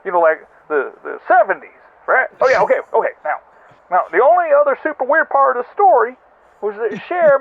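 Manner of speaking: ordinary delivery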